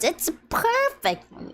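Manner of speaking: high gleeful sing-song. Shown as a demonstration. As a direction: say it thrilled, way up high. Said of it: "repeats it higher".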